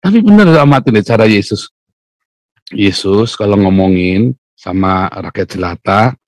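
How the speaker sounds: usual speaking voice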